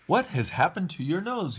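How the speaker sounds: high-pitched astonished question; crying out. A narrator's reading. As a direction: ask it high, in mild storybook alarm. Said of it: "he cried".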